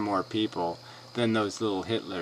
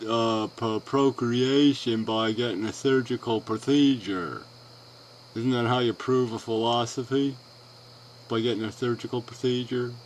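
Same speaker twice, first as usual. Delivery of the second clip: mildly raised mock-challenge voice; mocking quote; 3 semitones higher, 4 dB louder than usual. Imitating the other speaker challenging people with bullshit, lightly mocking. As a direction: lightly parody someone posing a silly gotcha. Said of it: "you'll challenge them with bullshit like".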